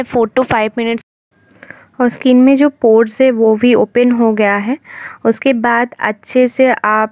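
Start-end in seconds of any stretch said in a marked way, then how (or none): 0.0-5.9 towel pressed to mouth; muffled by cloth on face; speaking through a towel. Real, not acted. Seen 0: Clear face until 1.1; towel pressed over mouth/nose from 1.3.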